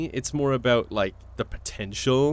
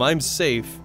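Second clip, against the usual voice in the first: ordinary delivery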